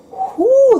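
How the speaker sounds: sharp high-pitched whoo at hot food; high whoo from heat. Real, not acted. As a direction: blow out a high whoo from the burn. Biting very hot corn.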